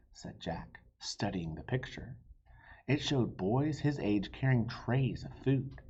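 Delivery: plain reading voice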